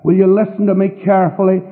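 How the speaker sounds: ordinary talk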